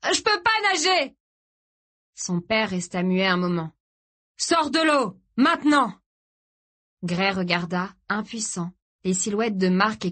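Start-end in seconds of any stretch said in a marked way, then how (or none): 0.0-1.1 high, desperate cry; high-pitched distressed howl; yell. A narrator's reading. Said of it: "hurla Gray dans le chaos". 4.4-6.0 high, commanding father's shout; loud high-pitched command; urgent shouted order. A narrator's reading. Father orders son out of sea.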